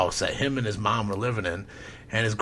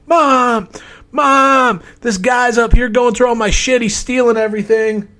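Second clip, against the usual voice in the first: high, loud yell imitating a tantrum; screaming for mom; 13 semitones higher, 11 dB louder, slower than usual. Son tattling to his mom about the inspector, screaming. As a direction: holler it way up high, mimicking an outraged son. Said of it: "He starts screaming".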